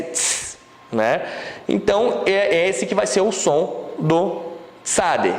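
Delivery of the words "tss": a sharp unvoiced tss hiss; teacher demonstrating the ts sound. Shown as a demonstration. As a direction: hiss tss like the end of volts. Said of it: "eu termino com T S".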